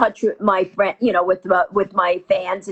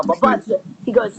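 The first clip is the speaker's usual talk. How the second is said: normal talking voice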